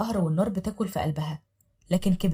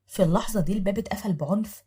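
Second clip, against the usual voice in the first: ordinary delivery, nothing marked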